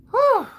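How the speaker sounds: high, loud whew; relieved whoop. Real, not acted. Woman feeling lighter after venting, relieved whew. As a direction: blow off steam in one high hoot.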